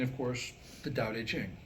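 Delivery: normal talking voice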